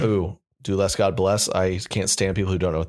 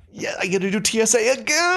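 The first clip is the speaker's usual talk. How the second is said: mock whine; 14 semitones higher than usual. Mock whiny traveler.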